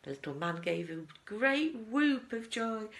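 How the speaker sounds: usual speaking voice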